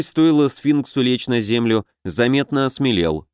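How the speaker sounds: ordinary delivery, nothing marked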